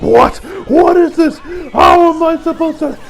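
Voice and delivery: high, loud frantic outburst; panicked shouting. Acted. Diavolo shouting in alarm as the man falls into the road. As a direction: sputter out shocked alarm.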